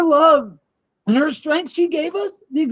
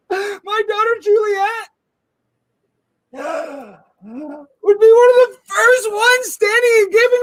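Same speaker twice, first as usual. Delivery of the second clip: fake sobbing; 5 semitones higher than usual. Fake grieving father press statement.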